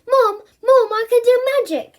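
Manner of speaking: Bertie bursting in, high and loud; excited shout. Acted. Boy bursting into kitchen, excited shout. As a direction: call out thrilled to Mom.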